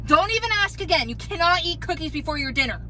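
ordinary delivery

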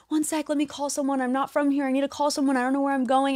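high-pitched imitation of a flustered friend; panicked friend stalling. Friend in shock stalling for help, flustered high imitation.

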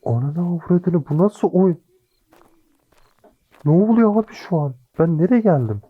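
real panic, voice shooting up; panicked. Real, not acted. Panicked after jump scare.

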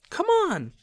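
very high, eager shout; excited call. Acted. Ron calling Harry toward the car, eager shout. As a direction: urge him on, thrilled.

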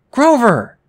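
high, loud startled call; surprised cry. Acted. Percy cries out on seeing Grover, surprised and loud. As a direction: call out his name in shock.

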